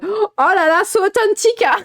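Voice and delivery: high, loud gushing mock delight; mock-impressed anglophones. Mocking English speakers charmed by the accent, hammed-up high gushing. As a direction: imitate a wildly impressed foreign audience. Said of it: "les anglophones doivent être en mode".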